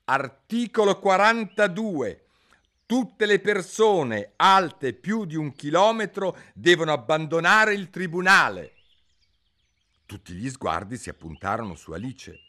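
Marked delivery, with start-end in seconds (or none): 0.1-8.8 king reading a made-up article aloud; proclaiming king. A narrator's reading. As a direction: read the law out like a verdict. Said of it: "leggendo ad alta voce sentenziò".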